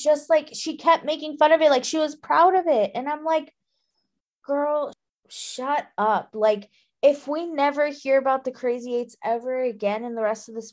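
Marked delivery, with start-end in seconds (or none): 4.4-6.3 put-on slow, drawn-out girl shut up; fed up. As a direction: roll your eyes and drag it out. Annoyed reader scolding a character.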